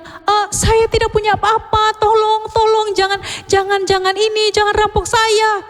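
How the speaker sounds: high, frightened begging voice; frightened pleading. Acted. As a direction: plead desperately with the robbers. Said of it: "ini laki-laki tadi bilang". (memohon ketakutan dengan suara tinggi)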